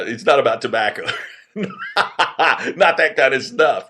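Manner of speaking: real laughter breaking into the words; laughing aside. Real, not acted. Storyteller joking that snuff film isn't about tobacco, laughing. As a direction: laugh through a joking clarification.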